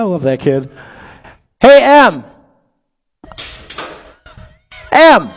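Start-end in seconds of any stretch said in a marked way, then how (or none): 1.6-5.3 high, loud call across the stands; cheerful loud hail from the booth. Real, not acted. Calls out to kid in crowd.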